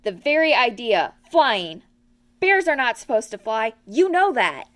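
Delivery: loud, indignant telling-off; scolding. A narrator's reading. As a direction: Rabbit lecturing Pooh for the crash. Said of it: "scolded Rabbit".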